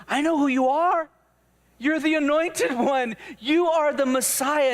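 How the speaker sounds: eager disciple proclaiming what he knows; excited declaration. Acted. Peter's acted declaration to Jesus.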